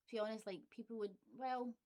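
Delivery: ordinary talk, nothing marked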